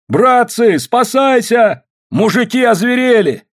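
high, loud alarm call; panicked shout. A narrator's reading. Someone warning the crowd of danger, panicked shout.

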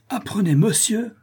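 high-pitched exasperated exclamation; indignant cry. A narrator's reading. Exasperated porter putting a passer-by straight, high indignant cry. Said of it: "cria monsieur Pipelet".

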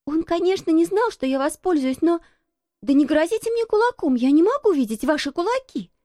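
put-on high-pitched, protesting little girl; high, indignant girlish protest. A narrator's reading. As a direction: plead your case in a high child's voice. Girl protesting at old man's threats.

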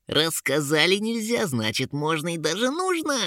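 high, cheeky creature voice; second eager imp. A narrator's reading. Imp argues for breaking the rule, high excited voice. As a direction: build gleefully to a high finish. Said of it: "подхватил идею второй".

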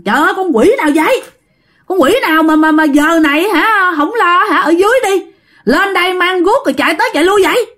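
high-pitched, rapid angry scolding; la giọng chửi. Acted. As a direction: scold them in a high, rushed voice, fed up with the racket. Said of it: "dì Năm mới la giọng ra". (la chửi bực tức, giọng cao)

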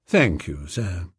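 a low, deferential valet's murmur; Jeeves. A narrator's reading. Valet answering his young master, low and deferential.

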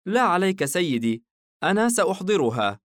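put-on a tiny girl sure of herself; girl, cheerful. الفتاة تطمئن حاييم. (صوت فتاة عالٍ وواثق)

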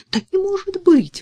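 high, loud cry of disbelief; mother crying out in alarm; exclaiming. A narrator's reading. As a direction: burst out in shocked protest. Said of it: "воскликнула мать".

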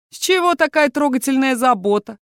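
mock-sweet rise, needling; a touch of jeering; snide mockery. Алиакс дразнит капитана насчёт его заботы, ехидно. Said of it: "Снова ехидный голос Алиакса".

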